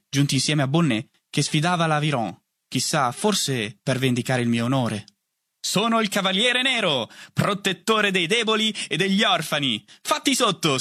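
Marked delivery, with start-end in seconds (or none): 5.6-10.8 high, theatrical champion's proclamation; knightly challenge. A narrator's reading. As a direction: proclaim it like a mock-heroic knight. Boy playing knight issues challenge.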